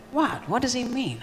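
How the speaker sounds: lightly voiced bewildered bird; confused birds. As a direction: birds puzzled by the poet, high and soft.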